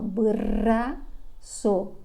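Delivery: braço drawn out very slowly, with the R in bra stressed; stretched syllables. Shown as a demonstration. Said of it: "Perceba o som da letrinha". (braço bem devagar, destacando o R)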